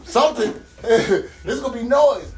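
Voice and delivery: ordinary talk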